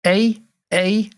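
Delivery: a token attempt at Scouse; Scouse accent. Shown as a demonstration. Podcaster trying all the Scouse he can do, Scouse accent. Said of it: "that's all I can do in a Scouse accent".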